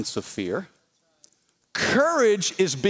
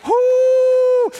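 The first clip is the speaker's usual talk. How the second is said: high, loud celebratory woo; excited whoop; 8 dB louder than usual. Real, not acted. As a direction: whoop like the church just got fired up. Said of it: "good preaching".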